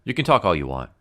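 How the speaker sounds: ordinary talk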